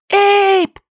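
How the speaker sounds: very high, loud moaning call; moaning cry. A narrator's reading. Li moans for Abe in fear. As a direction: moan his name in fear. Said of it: "стонала Ли".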